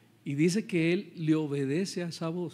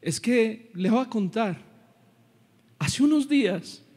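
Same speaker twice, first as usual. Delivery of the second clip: acted anguished testimony, high and loud; emotional weeping manager; 7 semitones higher, 4 dB louder than usual. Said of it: "empieza a llorar, inconsolable". (padre conmovido que suplica, voz alta y aguda)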